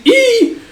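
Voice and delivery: very high, loud "eee" shriek; short startled yelp, hammed-up; frightened squeal. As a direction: squeal in fright at a sudden lunge. Man startled as Guts lunges.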